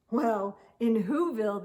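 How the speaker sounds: ordinary delivery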